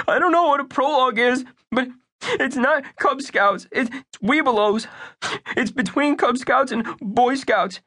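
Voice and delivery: put-on high, anxious young boy; earnest little boy. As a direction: play Bunky as a worried young kid. Bunky voice.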